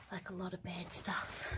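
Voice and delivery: soft unvoiced whisper. Real, not acted. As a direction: breathe the words out quietly. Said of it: "I'm gonna talk quietly".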